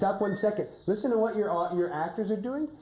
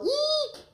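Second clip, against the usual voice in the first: very high squeal of pretend fright; mock eek. Shown as a demonstration. Coach showing Red a high frightened squeal. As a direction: shriek it like a startled little girl. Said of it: "can you go".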